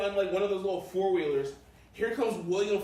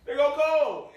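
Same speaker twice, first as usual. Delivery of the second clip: excited fan calling out; 8 semitones higher, 7 dB louder than usual. Retold or imitated. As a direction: shout it out high and loud. Fan spots Cole, shouts.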